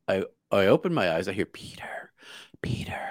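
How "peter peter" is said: whispered name, drawn from a dream; hushed, beckoning whisper; eerie calling whisper. Retold or imitated. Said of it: "hear my name being called".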